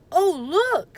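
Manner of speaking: oh, I just remembered; surprised. Acted. Jack remembering the medallion, surprised.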